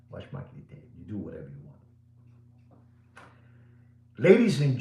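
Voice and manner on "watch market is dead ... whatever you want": breathy whisper of the verdict. Real, not acted. Dealer whispering that the market is dead, whisper. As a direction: whisper it like a secret.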